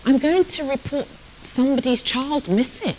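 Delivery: mock outrage from prim English passengers; fussy English passengers. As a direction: play a flustered passenger on the bus. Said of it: "the English people would start, you know, saying".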